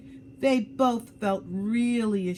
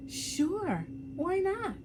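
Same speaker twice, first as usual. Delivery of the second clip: high, bright-hearted eager reply; grinning; 12 semitones higher, slower than usual. Acted. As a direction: say it with a grin. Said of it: "Salma grinned".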